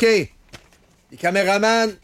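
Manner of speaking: high, slow, drawn-out call; camera crew countdown. Acted. Host mimics a crew cueing the cameras, high drawn-out countdown.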